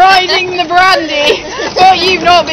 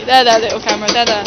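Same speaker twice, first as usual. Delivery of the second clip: mock soothing; 5 semitones lower, faster than usual. Soothing camera mockingly.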